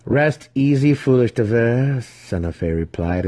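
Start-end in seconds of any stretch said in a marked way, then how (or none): 0.0-2.0 pitying. A narrator's reading. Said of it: "there was pity in her voice".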